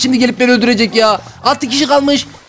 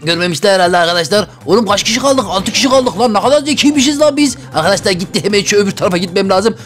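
usual speaking voice

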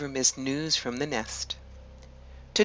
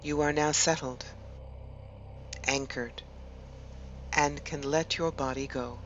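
measured meditation voice with long pauses between phrases; guided meditation; 6 dB quieter than usual. Acted. Host guiding listeners to relax and imagine an ancient wood, guided meditation. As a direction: lead them gently inward, leaving space after each phrase.

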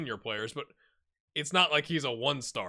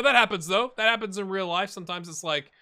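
normal talking voice

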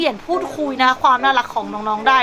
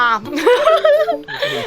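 squealing laugh, pitched way up; high squeal of laughter; 12 semitones higher than usual. Real, not acted. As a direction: let out a high delighted giggle. Laughing at sushi comparison.

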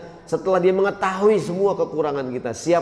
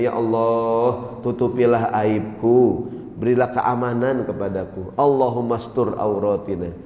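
lowered, measured prayer voice; prayer recitation; 4 semitones lower than usual. Acted. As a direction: recite the supplication unhurriedly, drawing out the words.

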